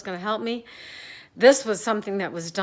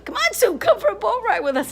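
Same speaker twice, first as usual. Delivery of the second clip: high, cheery call from the boat; neighbors calling; 10 semitones higher, 6 dB louder than usual. Retold or imitated. Neighbors on the boat inviting Sue, high cheery call. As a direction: call her out onto the lake, louder and higher.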